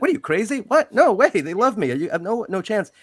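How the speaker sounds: high, loud mock disbelief; a gangster sneering at his friend's plan; incredulous gangster. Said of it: "And he's like".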